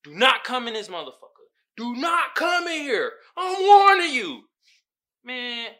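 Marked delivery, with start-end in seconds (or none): 0.0-4.4 mock cop, building to a loud warning; lightly voiced cop yelling a warning. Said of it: "was like".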